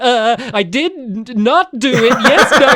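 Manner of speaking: high, loud hammed-up kids' show liar; bad pretending. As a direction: pretend very badly, like a cartoon kid caught out. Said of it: "a character, like, pretending".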